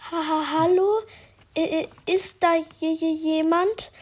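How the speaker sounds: stammering, high and loud, scared stiff; nervous stuttered hello into the attic; trembling call. Acted. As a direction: call out to the dark, voice shaking with fear. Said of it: "rief ich mit zitternder Stimme".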